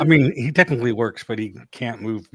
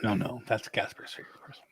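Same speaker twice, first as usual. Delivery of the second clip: whispering; 8 dB quieter than usual. Real, not acted. Whispered aside.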